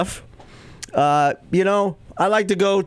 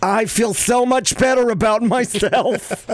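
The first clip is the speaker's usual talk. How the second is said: mom voice; 6 semitones higher than usual. Retold or imitated. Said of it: "She's like".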